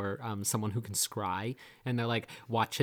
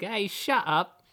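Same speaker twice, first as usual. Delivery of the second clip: much higher, louder scoffing voice; mocking companion; 10 semitones higher, 6 dB louder than usual. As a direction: scoff at him loud and high. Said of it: "she's like".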